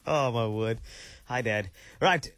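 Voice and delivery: ordinary talk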